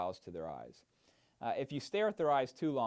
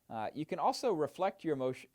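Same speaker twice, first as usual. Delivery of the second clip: ordinary talk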